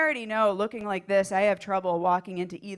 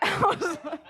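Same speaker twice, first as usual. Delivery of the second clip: ordinary talk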